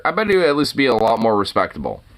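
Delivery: ordinary talk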